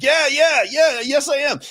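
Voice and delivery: high, quick flustered yeses; imitating his own surprised answer; startled eager reply. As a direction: caught off guard, blurting yes. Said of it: "And I was like".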